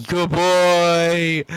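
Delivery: loud high praise for a dog; squeaky praising a dog. Real, not acted. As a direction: cheer the dog on.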